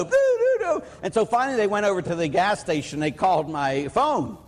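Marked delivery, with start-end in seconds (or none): 0.0-0.8 high sung ding-dong of a doorbell; mimicking the doorbell ringing at 2 am; doorbell imitation. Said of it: "doorbell repeats".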